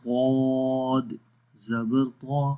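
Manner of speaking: ضاد drawn out very slowly, heavy and full-mouthed; ضاد زبر ضا recited slowly, the ضا held long with the tongue's side pressed up; spelling out ḍād. Shown as a demonstration. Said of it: "اب اس کی مثالیں پڑھتے ہیں". (ضاد کو بہت آہستہ اور بھاری آواز میں کھینچ کر پڑھیں)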